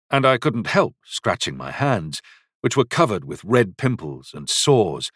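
usual speaking voice

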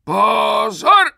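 high, very loud drill command, stressed on the second syllable; unnatural bellow. A narrator's reading. Sergeant suddenly calling recruits to attention, unnatural bellow. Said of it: "nepřirozeně zařval".

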